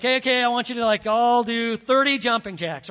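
loud, bossy gym teacher call. Retold or imitated. Gym teacher ordering the class to start jumping jacks, loud and bossy. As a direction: bark it out like a coach to a class.

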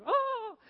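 high, loud mock-flustered "oh"; flustered cry. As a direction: imitate a flustered driver's wail. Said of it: "she is flustered".

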